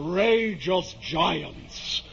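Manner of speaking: slow, high, grandiose knight; slow proclamation of giants; grand knight. Acted. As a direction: savour the word outrageous like a hero. Knight spots the windmills.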